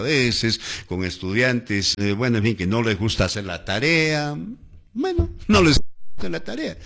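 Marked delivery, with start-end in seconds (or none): 4.9-6.8 high, fast put-on student voice; imitating a careless pupil; mock student voice. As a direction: mimic a lazy student brushing off homework. Teacher mimics lazy students.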